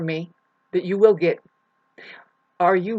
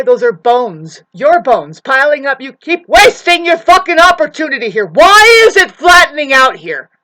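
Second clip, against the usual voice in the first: louder and louder, ending in a higher full shout; furious rant; 6 semitones higher, 12 dB louder than usual. Acted. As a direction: build to a furious shout at the listeners. Ranting at followers.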